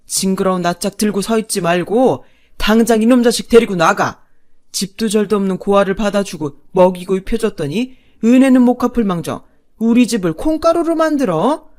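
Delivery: loud, raging father's roar; father-in-law bellowing at his family; bellowing in rage. Acted. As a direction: roar at your son and wife to get out. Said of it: "아버님이 버럭 소리쳤죠".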